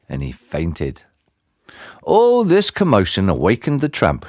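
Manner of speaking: ordinary delivery, nothing marked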